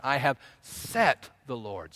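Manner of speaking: "set" punched and held, slow phrase; stress on SET. Shown as a demonstration. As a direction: land hard on "set" and pause. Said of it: "you emphasize each word one at a time".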